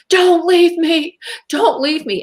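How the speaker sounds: acted-out desperate cry; almost screaming. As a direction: beg on the edge of a scream. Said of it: "I was almost at a scream".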